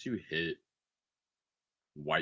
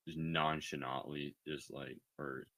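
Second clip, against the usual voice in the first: ordinary talk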